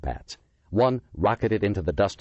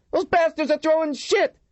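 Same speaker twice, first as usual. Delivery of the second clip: panicked yell; 4 dB louder than usual. A narrator's reading. Officer panicking under barrage.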